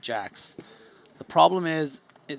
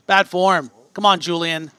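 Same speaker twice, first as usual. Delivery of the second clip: mock scolding; 4 semitones higher, 11 dB louder than usual. Real, not acted. Scolding player jokingly.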